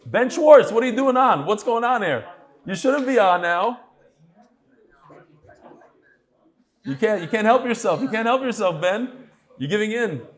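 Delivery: mock-stern telling-off of a viewer; mock scolding. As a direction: tease him like a caught schoolboy. Host teasing viewer for watching.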